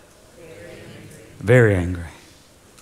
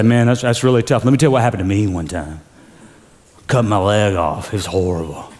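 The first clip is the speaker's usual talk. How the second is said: lower, mock dismissive one-upping; a hint of a friend who steals your pain; self-centered friend; 8 semitones lower than usual. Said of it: "but me people are the worst".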